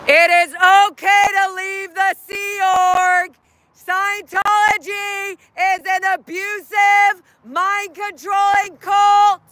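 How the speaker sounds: high, loud, slow street callout; drawn-out, projected protest yell; protest shout. Real, not acted. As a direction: bellow each word to the street. Shouts protest messages at passers-by.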